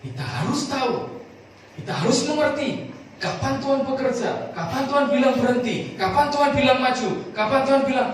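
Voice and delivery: high, loud, driving preacher's voice; fervent preaching. Acted. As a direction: preach it to the congregation with fire. Preaching at full force. (berkhotbah dengan berapi-api)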